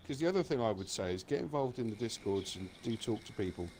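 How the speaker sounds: ordinary talk, nothing marked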